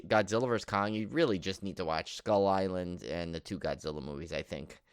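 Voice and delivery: normal talking voice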